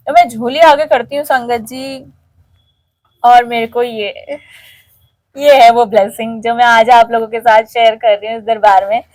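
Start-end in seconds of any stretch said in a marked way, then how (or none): none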